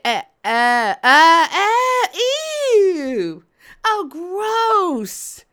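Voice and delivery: stammered grunts climbing to a loud, high "ew"; dawning disgust. Retold or imitated. Storyteller realises how bushwandas earn living, loud disgust. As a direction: wail it out, totally grossed out.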